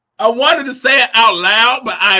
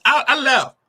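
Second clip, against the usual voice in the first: normal talking voice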